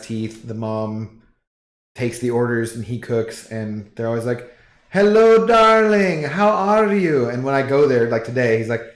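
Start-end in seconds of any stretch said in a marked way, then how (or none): 4.9-7.3 high, loud, sing-song greeting, slowing at the end; greeting a regular, the mom calls hello darling fondly; doting restaurant mom. Retold or imitated. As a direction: gush like a fond shop owner.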